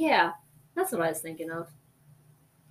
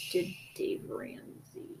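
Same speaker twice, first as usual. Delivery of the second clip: whispered; 12 dB quieter, slower than usual. Real, not acted. Confessing aside.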